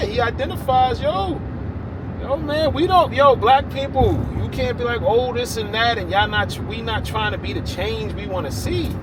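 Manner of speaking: high, loud fired-up rant; excited rant. Real, not acted. Man venting to viewers about his people, high loud rant.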